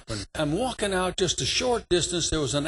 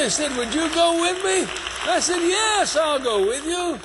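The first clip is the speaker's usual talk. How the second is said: fervent promise; 6 semitones higher than usual. Retold or imitated. Preacher promising to stand by him.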